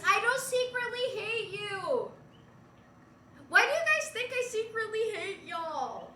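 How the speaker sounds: loud, high plaintive protest; indignant protest. Real, not acted. Streamer denying secretly hating chat, indignant protest. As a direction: protest it loudly with rising pitch. Mock protest to chat's accusation.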